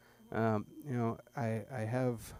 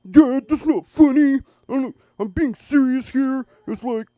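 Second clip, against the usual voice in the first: much higher, louder, slow protest; mock whiny kid; 18 dB louder than usual. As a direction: whine it at dad. Son upset, voiced.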